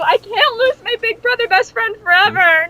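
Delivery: high, loud, hammed-up begging through laughter; mock frantic pleading. Begging Knuckles not to go meet Satan, hammed-up high wail. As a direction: cry out as if your friend is being dragged off.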